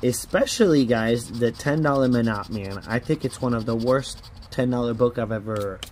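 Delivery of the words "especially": ordinary talk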